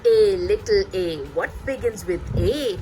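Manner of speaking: put-on lively sing-song reading to a small child; animated read-aloud. Shown as a demonstration. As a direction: bring the rhyme to life for a toddler. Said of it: "I really animate my voice and I read it like".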